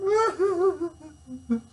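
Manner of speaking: high wailing blubbering sob; mock sob.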